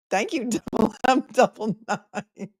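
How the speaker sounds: laughing thanks. Real, not acted.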